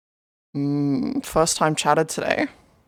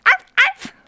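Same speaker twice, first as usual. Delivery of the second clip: squeaky double puppy arf; high yappy barks; 5 dB louder than usual. Pretend. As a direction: yap twice like a tiny dog. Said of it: "Is it better if I'm like".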